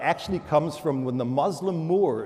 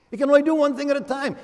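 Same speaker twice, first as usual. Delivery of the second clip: excited exclamation; 12 semitones higher, 5 dB louder, much faster than usual. Real, not acted. Punchline called out.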